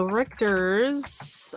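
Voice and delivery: slow, drawn-out German-accented name; Richter's said slowly in a mock German accent. Shown as a demonstration. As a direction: stretch it out the German way, Richter's rising at the end. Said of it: "I don't know why I have to have the accent".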